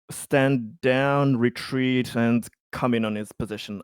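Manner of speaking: usual speaking voice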